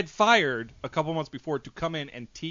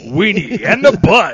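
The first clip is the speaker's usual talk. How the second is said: silly clown; 5 semitones higher, 12 dB louder than usual. Pretend. Silly show-name parody.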